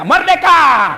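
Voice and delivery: high, loud mock cheer of freedom; joyful shout. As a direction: yell it like a gleeful husband. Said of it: "suamimu masuk kamar berteriak".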